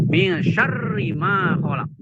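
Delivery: "Min syarri ma khalaq" slow with doubled r in syarri; Quranic recitation demo. Shown as a demonstration. Teacher reciting the example slowly, stretched. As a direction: recite "syarri" with a long held rr. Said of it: "Contoh, contohnya".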